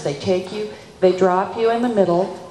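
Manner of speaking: ordinary talk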